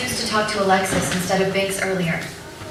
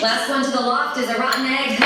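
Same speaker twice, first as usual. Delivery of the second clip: usual speaking voice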